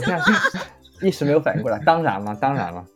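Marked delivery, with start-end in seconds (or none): none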